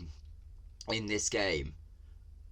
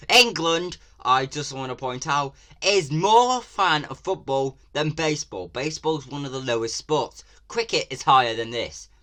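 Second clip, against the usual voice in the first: louder and higher, heated complaint; indignant rant; 5 semitones higher, 7 dB louder than usual. Real, not acted. Vlogger complaining loudly about English sports. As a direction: lecture them, fired up. Ranting at the trailer.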